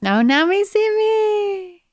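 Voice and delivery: high, stretched-out singsong echo; playful title echo. Pretend. Co-host echoing a title back in a high, drawn-out voice. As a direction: draw it out high and silly.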